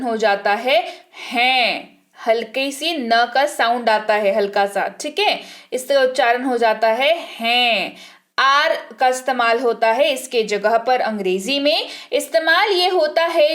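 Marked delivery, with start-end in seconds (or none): none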